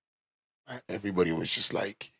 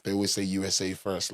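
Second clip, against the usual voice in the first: normal talking voice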